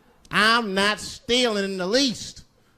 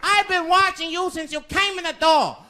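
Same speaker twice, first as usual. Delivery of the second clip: shopkeeper voice; 13 semitones higher than usual. Retold or imitated. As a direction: bark it out as the angry old store owner. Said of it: "Mister Fong said".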